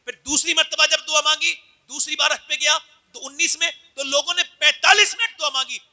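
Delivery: heated preacher's build; rising sermon. Acted. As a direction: drive the story up from the pulpit.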